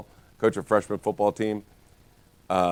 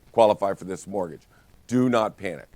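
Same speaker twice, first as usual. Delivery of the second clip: normal talking voice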